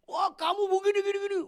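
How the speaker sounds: hammed-up road rage; mock angry driver. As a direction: mimic an angry driver yelling. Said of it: "Dia tuyuk-tuyuk tuh supir taksi".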